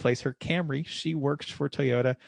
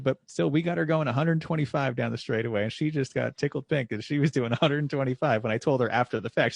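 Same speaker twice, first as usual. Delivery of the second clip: usual speaking voice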